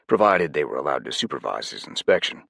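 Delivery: ordinary delivery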